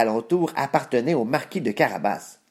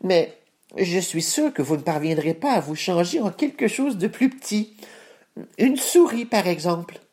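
cat voice; 8 semitones higher than usual. Acted. Cat character voice.

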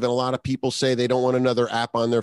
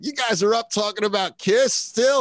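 put-on higher-pitched, amazed teenage fan; excited sixteen-year-old self; 6 semitones higher than usual. Teen self reacting to grown friends still talking Kiss, excited. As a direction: marvel like a thrilled teen. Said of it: "your sixteen-year-old self will be like".

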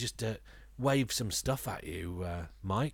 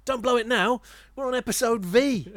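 excited; 11 semitones higher, 7 dB louder than usual. Acted. Hyping up the episode number.

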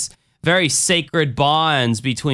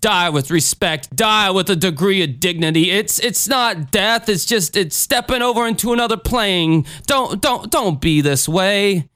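Jim Jones; 5 semitones higher than usual. Acted. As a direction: exhort the flock, drawing out phrases. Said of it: "Jim Jones".

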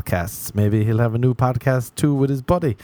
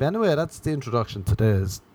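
usual speaking voice

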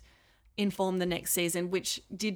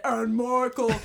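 louder, broad mock Australian accent; hammed-up copy of the kids' accent; impersonated accent; 4 semitones higher, 6 dB louder, slower than usual. Bandmate retelling the impersonation at a talk, exaggerated Australian accent. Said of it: "I impersonated it and said".

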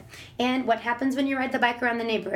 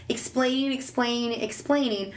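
normal talking voice

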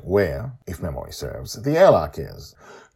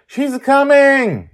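put-on high, loud, slow thrilled girl; yelp; 11 dB louder, slower than usual. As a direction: squeal the good news. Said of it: "Joy yelps".

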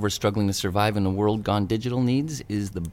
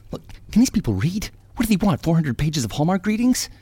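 raised, exasperated griping; indignant rant; 6 semitones higher, 4 dB louder than usual. Acted. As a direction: rail at the editors in disbelief. Rants about editors who rejected him.

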